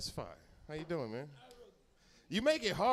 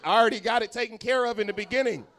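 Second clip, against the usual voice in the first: high, loud shout at a latecomer; mock outrage; 7 semitones higher, 12 dB louder than usual. Real, not acted. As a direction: call out the late guy, mock angry. Yelling at latecomer.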